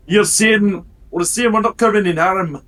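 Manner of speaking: put-on loud, high-pitched Dwarvish accent; Dwarvish yell. Dwarf calls round the door frame, Dwarvish yell. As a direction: call out in a hammed-up Dwarvish voice. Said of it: "yell out in Dwarvish".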